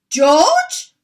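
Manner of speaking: high, loud call; shouting. Acted. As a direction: shout his name urgently. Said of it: "Mister Cranky shouted".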